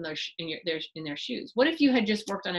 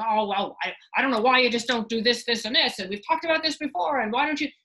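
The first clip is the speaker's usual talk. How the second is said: lecturing husband; 2 semitones higher, 5 dB louder than usual. Acted. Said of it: "And he goes".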